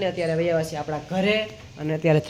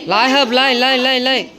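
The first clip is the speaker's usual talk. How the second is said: urgent coaxing; 6 semitones higher, 4 dB louder than usual. Real, not acted. Coaxing a pet into cage.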